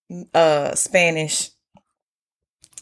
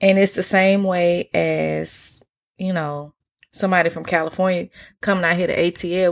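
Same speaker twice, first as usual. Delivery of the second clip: ordinary talk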